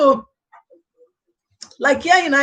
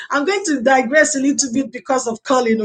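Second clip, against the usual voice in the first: ordinary talk, nothing marked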